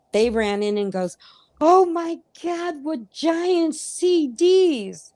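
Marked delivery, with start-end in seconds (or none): 1.6-5.1 put-on high, slow kid voice gasping in awe; imitating the kids. Kids marveling at dad's records, high and slow. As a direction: mimic the kids' wide-eyed amazement. Kids exclaiming in retold story.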